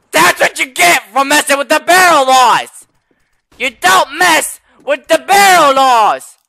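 building rant. Acted. Cashier scolds Gary, building to a slow shout. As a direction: build to an outraged yell. Ranting about barrel laws.

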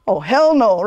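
loud, outraged "hell no"; indignant outburst. Retold or imitated. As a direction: call it out, loud and appalled. Outraged at tourists running over elder.

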